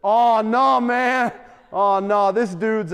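loud mock-drunk protest; loud drunken outburst, imitated; slurred drunk. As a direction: play a loud, slightly slurred drunk guy. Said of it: "with a little slurred voice".

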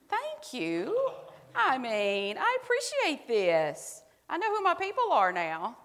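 high, drawn-out grateful gush; delighted thanks. Real, not acted. Delighted thanks for raised hands.

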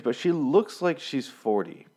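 ordinary delivery

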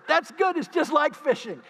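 high-pitched, delighted retold remark; pleased exclamation. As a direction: exclaim it with rising glee. Preacher retells his gleeful reaction.

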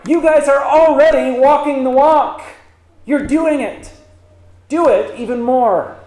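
lifted, affirming exhortation; encouragement. Acted. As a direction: cheer them on to keep at it. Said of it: "This is meant to be encouragement".

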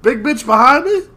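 high, loud pointed call-out; loud mock confrontation; mock McShane. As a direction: stare him down and call him out. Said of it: "He stares the Hound down like".

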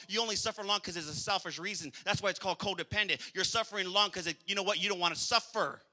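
ordinary talk, nothing marked

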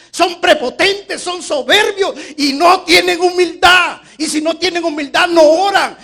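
loud, high, slow pulpit cry; preacher at full force; fiery preaching. Acted. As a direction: thunder it at the congregation.

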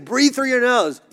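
high, loud barked order; coach ordering. Retold or imitated. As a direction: snap the instruction like an impatient coach. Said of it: "He's like".

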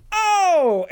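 long, high-pitched exclamation; hammed-up high reaction; mock astonished cry. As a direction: echo it as a high cartoon cry. Acted cartoon heroes reacting.

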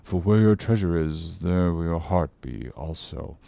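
low, soft pious mumble; mumbling to himself. A narrator's reading. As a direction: read half to yourself, low and hushed. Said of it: "went on mumbling".

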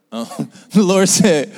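loud high laugh into the line; laugh, then say the Lord said. Real, not acted. Preacher laughing at her own restart.

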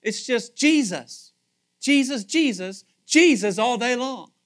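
loud, slow, drawn-out praise; rising preaching exultation. Real, not acted. Preacher to congregation describing heaven, loud slow exultation.

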